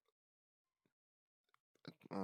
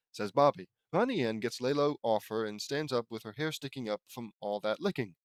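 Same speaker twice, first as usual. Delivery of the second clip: usual speaking voice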